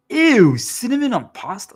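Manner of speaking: high, loud grossed-out squeal; disgusted. Retold or imitated. Friend reacting to cinnamon pasta, disgusted. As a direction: recoil at the idea.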